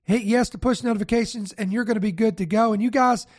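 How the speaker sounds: ordinary talk, nothing marked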